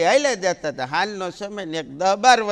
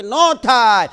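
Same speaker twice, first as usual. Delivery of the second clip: louder, the last words slow and stretched; defiant shout; 5 semitones higher, 12 dB louder, much slower than usual. Acted. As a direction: yell back stubbornly, dragging it out. Son shouting back at mother. (જીદ્દી દીકરાની મોટી બૂમ)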